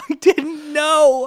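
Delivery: high, slow, sheepish protest; sheepish high plea. Retold or imitated. Boyfriend pleading innocence to his grieving girlfriend, high and slow. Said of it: "And I'm like".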